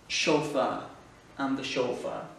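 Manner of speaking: chauffeur said SHOW-fer with an English R; English accent. Shown as a demonstration. Teacher demonstrating English-style words to French learners, English accent. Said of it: "il faut les dire avec un accent English".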